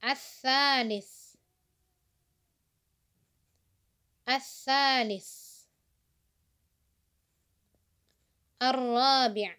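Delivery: slow, drawn-out model pronunciation; teacher modelling each word for repetition; listen-and-repeat drill. Shown as a demonstration. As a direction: stretch the long vowel and let it fall. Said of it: "dengarkan, a'id, ulangi".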